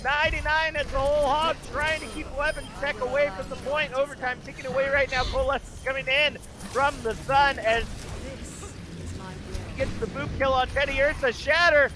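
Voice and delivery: high, urgent overtime commentary; excited play-by-play. Real, not acted. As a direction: race through each kill as it happens. Play-by-play through overtime climax.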